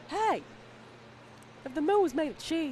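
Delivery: a hint of a gravel-voiced ballpark broadcaster; Harry Carey impression. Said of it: "Harry Carey is the".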